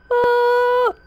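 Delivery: loud, high held scream. Real, not acted. Player panicking at the game, screaming. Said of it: "Don't know why I'm screaming".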